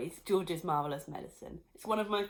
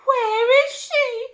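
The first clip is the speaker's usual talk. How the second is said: high, loud, slow panicked cry; 14 dB louder, much slower than usual. Acted. Missus Cranky crying out for her vanished mother. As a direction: cry out searching. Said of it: "cried Missus Cranky".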